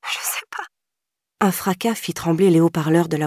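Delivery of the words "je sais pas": softer, breathy whispered sob; tearful whisper. A narrator's reading. Girl whispering through sobs to the operator. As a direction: fade into a broken whisper. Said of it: "Ils l'entendirent sangloter".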